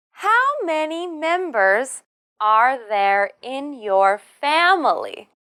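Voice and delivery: slow, high "How", falling "family"; slow question, down at the end. Shown as a demonstration. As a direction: drop the voice on "family". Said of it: "your voice should go down at the end".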